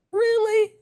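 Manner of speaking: very high, disbelieving squeak; mock outrage, as if she can't be serious; incredulous. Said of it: "I was like".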